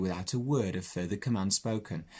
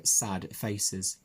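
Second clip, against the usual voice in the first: usual speaking voice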